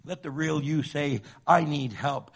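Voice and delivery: loud, urgent pulpit voice; full-force preaching. Acted. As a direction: preach it out hard to the crowd.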